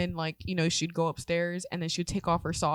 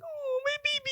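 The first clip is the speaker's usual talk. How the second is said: very high, soft cooing mom voice; high cooing mother; doting mom; 6 dB quieter than usual. Retold or imitated. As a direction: mom gushing over old photos. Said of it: "She's like".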